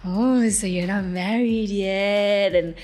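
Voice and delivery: pry like a sleazy host; hint of a creepy podcast host. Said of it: "he's going".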